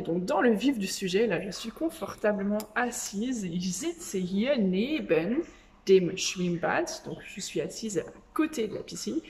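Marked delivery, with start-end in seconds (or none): 3.4-6.9 German sentence said very slowly and clearly; slow model sentence for French learners of German; slow teaching demo. Shown as a demonstration. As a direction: lay out each word slowly for learners. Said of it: "Je vais t'apprendre du vocabulaire".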